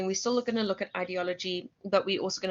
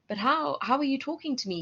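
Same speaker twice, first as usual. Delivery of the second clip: lightly voiced baffled outsider, pitched higher; a hint of a clueless online stranger, mock disbelief; incredulous foreigner; 6 semitones higher, faster than usual. Teacher mimics a foreigner chatting online, higher mock disbelief. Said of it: "they go".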